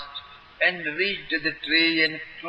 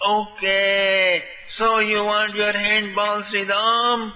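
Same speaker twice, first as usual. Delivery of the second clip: higher, louder sweet call from the treetop; 7 semitones higher, 4 dB louder than usual. A narrator's reading. Krishna calling down from the treetop to Sri Ram, sweet and high. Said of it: "Krishna's sweet voice echoed from the treetop".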